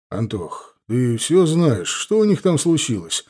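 usual speaking voice

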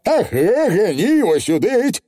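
high, puffing grumpy Feegle; high huffy pixie; huffing Feegle. Acted. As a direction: puff it out still sore. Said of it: "пропыхтел Явор".

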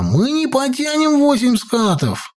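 high, stretched alarm cry without real volume; mock shouting. A narrator's reading. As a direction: urge a getaway in a high boy's voice. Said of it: "закричал Петька".

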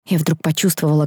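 ordinary delivery

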